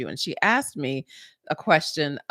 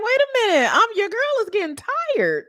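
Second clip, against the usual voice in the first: exasperated squeal; much faster than usual. Retold or imitated. Retelling being worn out on the court, high exasperated squeal. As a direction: squeak it out like you're wiped out on the court. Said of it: "and I'm like".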